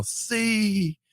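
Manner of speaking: high, drawn-out stadium cheer at held-back volume; mock booming yell. Beer man yelling across the stadium, imitated booming call. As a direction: imitate a long, high crowd call without full volume. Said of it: "this big booming voice".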